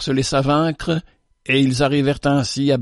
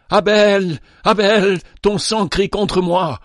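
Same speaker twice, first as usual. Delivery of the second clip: high, loud despairing call; desperate cry; 7 semitones higher, 4 dB louder, slower than usual. Acted. Cain cries out to his dead brother in despair. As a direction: cry the brother's name in guilt. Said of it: "sur ces paroles désespérées".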